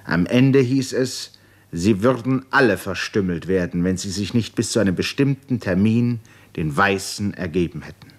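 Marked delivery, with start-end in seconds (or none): none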